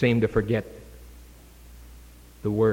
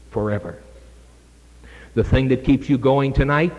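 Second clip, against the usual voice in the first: ordinary talk, nothing marked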